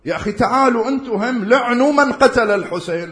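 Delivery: high, loud call to the other side; loud preaching challenge. Acted. Preacher challenging opponents, high and loud. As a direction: call them out from the pulpit.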